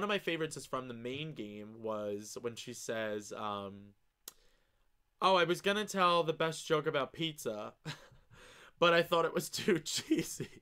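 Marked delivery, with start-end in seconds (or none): 5.2-10.6 laughing. Real, not acted. Reads joke, cracking up.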